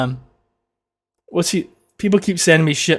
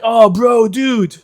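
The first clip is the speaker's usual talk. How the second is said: higher mock-amazed gasping; hyped onlooker; 6 semitones higher, much slower than usual. Onlookers amazed by a bottle trick, high mock awe. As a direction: gush as if wildly impressed, mockingly. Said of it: "It's like".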